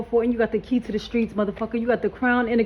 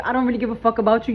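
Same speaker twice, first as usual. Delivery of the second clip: ordinary talk, nothing marked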